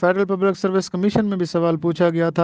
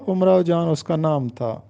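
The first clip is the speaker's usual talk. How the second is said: ordinary delivery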